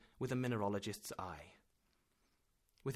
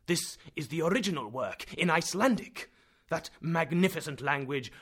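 loud, higher scornful tirade; animated professor; 4 semitones higher, 9 dB louder than usual. A narrator's reading. Professor scolding nephew about Icelandic, loud and scornful. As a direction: scold him with passionate contempt. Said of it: "the professor replied animatedly".

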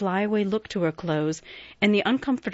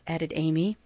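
usual speaking voice

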